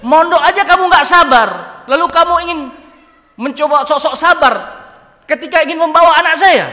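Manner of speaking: father shouting down a would-be son-in-law; angry father. Acted. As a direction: tear into him like an outraged father. Father scolds the impatient suitor.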